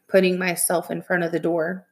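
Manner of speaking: ordinary delivery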